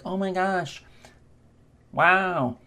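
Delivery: high, softened mock awe; wide-eyed, put-on astonishment; wide-eyed amazement. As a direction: gape in wonder, pitched well up. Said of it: "when the eyebrows are raised".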